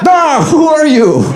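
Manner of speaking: startled, very high-pitched mock cry; Biden impression. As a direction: jump in alarm at a stranger, drawn out. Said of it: "forgetting and remembering your president".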